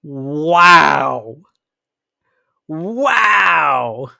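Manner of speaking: loud, drawn-out wow of disbelief; stunned, exclaiming in real disbelief; aghast wow. Real, not acted. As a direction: let each wow swell, slow and loud. Reacting aghast to the graphic.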